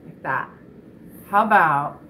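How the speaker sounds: ordinary delivery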